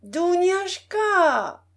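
long, high drawn-out call across the house. A narrator's reading. Old princess calling her maid again, high and very slow. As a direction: stretch the name slowly, calling the maid. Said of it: "répéta la vieille dame".

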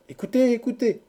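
high crowd call for attention; shouted chorus. A narrator's reading. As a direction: call out like an eager audience. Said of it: "cria le chœur". (cri aigu du chœur)

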